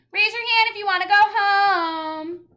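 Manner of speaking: high, loud cheeky yell. Acted. Child yelling to escape reading aloud. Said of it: "I yelled".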